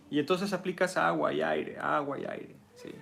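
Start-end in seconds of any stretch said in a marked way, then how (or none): none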